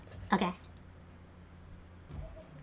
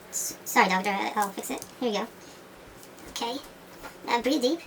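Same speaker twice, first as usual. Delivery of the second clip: ordinary talk, nothing marked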